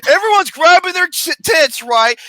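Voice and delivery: normal talking voice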